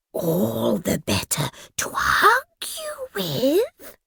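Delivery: slow, soft wolfish whisper. Acted. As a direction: breathe it, slow and sly. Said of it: "the wolf whispered".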